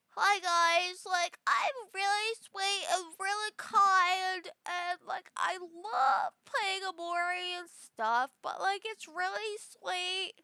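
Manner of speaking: high, slow sugary character voice; sweet and slow, way up high; cow pitch voice. Pretend. Streamer showing her cow voice, high and sweet. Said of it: "I'll start with my cow pitch".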